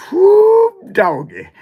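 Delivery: high squealing whoop of disbelief; excited whoop. Real, not acted. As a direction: yelp it out in shock. Exclaiming about the bad figure.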